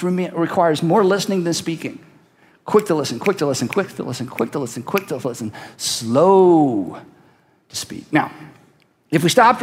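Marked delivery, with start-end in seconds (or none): 2.6-5.5 quick, quiet rattled-off chant; hushed, hurried repeats; rapid repetition. Shown as a demonstration. 5.8-7.0 SLOW stretched long and low; one long, lingering slow; drawn-out word. Shown as a demonstration. Draws out slow after quick repeats.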